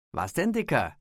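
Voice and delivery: etwas höher, frech gestichelt; neckend. A narrator's reading. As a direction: den anderen spielerisch aufziehen. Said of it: "neckte sie ihn".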